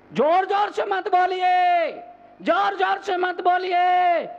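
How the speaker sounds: high, sustained preacher's shout; loud shout. Real, not acted. Preacher loudly telling people not to speak loudly, comic full shout. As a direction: belt it out high, holding the last word. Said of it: "जोर-जोर से बोलने के लिए तरुण सागर ये बहुत है".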